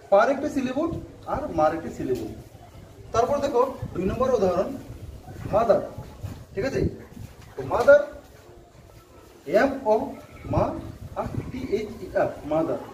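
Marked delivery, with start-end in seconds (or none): none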